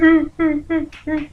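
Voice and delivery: short high repeated squeals; squeamish oohs. Retold or imitated. Watching coworker smash the bug, squeamish oohs. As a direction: re-enact wincing at each hit.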